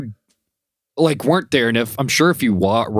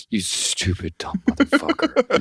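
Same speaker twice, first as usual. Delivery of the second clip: exasperated insult; 10 semitones higher, 3 dB quieter than usual. Retold or imitated. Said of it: "I'm like".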